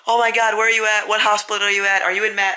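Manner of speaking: high-pitched panicked mom, imitated; acted-out panic, pitched well up; freaking out. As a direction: fire off alarmed questions like a frantic mother. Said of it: "she starts freaking out".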